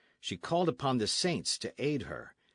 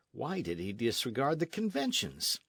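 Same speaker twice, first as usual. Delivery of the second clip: usual speaking voice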